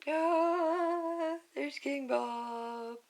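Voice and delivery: high, slow minion squeak. Acted. Reader voicing the minion Bob with a high squeak.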